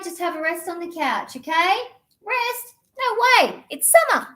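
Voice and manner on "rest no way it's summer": put-on high, loud kid outraged at resting; indignant kid. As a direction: protest like a child who won't stop. Kid refusing to rest.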